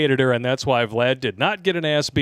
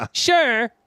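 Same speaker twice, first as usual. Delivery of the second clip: high stripper voice; 12 semitones higher, 4 dB louder than usual. Acted.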